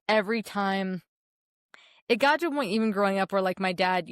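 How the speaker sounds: ordinary delivery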